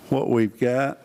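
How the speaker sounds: ordinary talk, nothing marked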